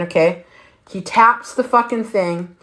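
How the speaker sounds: ordinary talk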